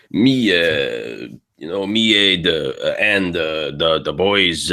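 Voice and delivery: Quebec French accent with drawn-out words; rough Quebec accent. Shown as a demonstration. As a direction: mimic a rough Quebecois, French filler sounds. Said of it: "you can add it in there".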